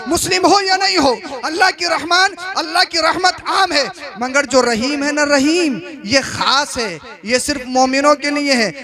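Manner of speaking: emphatic sermon oratory, pressing each point; forceful Urdu preacher's delivery; impassioned preaching. Real, not acted. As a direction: drive the lesson home to the congregation.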